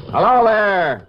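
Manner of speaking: shouted hello across a dark slope; calling out. Acted. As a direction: call far up the hill to someone unseen.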